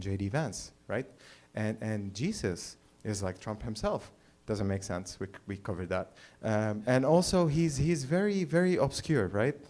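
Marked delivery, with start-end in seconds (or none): none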